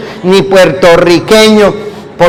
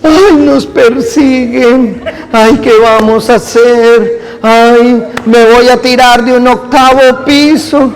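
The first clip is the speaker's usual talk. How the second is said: mock lament; 4 semitones higher than usual. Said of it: "no fue ponerse".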